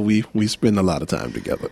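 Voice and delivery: ordinary delivery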